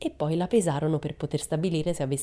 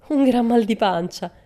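cheeky; 8 semitones higher, 8 dB louder than usual. A narrator's reading. Pippi's cheeky answer.

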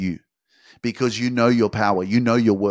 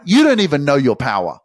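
ordinary talk

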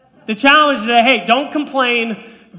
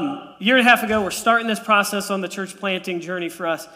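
normal talking voice

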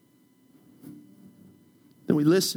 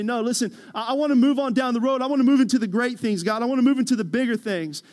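higher, louder, fast eager plea to God; eager pleading; 7 semitones higher, 6 dB louder, faster than usual. Acted. Churchgoer pleading with God to move on, eager and fast. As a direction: rush the words like a restless believer.

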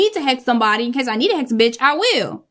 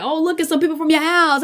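higher mocking sing-song; lightly voiced teasing friends; 6 semitones higher, faster than usual. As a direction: rib her like her friends did. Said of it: "they be like".

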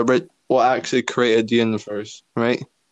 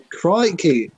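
higher-pitched mock Australian exclamation; attempted Australian accent; 5 semitones higher than usual. Shown as a demonstration. Showing what they can say, in an attempted Australian accent. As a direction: throw it out as a stock Australian cry. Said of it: "Can't stop speaking Australian".

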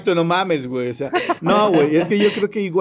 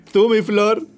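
normal talking voice

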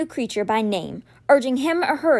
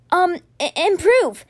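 flustered shout; 7 semitones higher, 4 dB louder, slower than usual. A narrator's reading. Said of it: "he shouted".

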